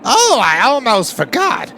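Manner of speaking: high, loud, gleeful mascot voice; Monokuma. Acted. Bear mascot gleefully announcing the corpse cleanup to students, high and loud. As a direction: play the cheerful villain bear delighting in the corpses. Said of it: "Monokuma told us".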